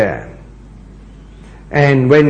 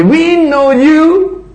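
high, slow, contemptuous "we know you"; slowed, pitched-up scorn; scornful brothers; 13 semitones higher, 4 dB louder, much slower than usual. Acted. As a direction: sneer down at the little brother.

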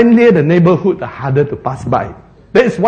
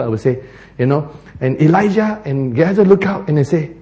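usual speaking voice

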